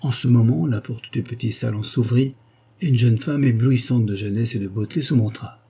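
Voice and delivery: plain reading voice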